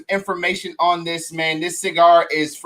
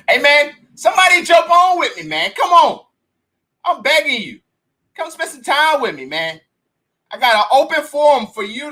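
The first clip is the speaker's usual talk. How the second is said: calling out; 8 semitones higher than usual. Real, not acted. Calling out to live viewers.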